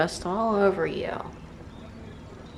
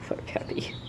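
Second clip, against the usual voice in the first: whisper; 9 dB quieter than usual. Real, not acted. Cooing at cat.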